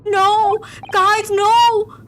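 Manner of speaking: loud, high, crying-style protest; mock wail. Streamer protesting to chat about the game, mock wail.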